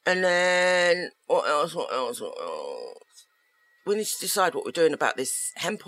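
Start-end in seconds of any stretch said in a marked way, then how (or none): 2.2-3.2 dropped low and quiet, playful; low mock voice. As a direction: sink the repeat into a low grumble. Drops voice on the repeat.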